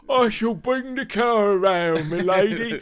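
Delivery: high, loud, drawn-out mock chauffeur impression; Thunderbirds driver. Co-host showing how Penelope's driver speaks, exaggerated chauffeur voice. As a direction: ham up a slow, deferential puppet-show driver. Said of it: "but that's the way he speaks".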